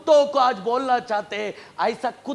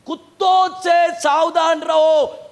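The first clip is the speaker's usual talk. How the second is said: slow, drawn-out higher warning; warning call; 5 semitones higher, 4 dB louder, slower than usual. Acted. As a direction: stretch it out as a preacher's warning. Preacher calls out the verse warning. (धीमी, खिंची हुई चेतावनी)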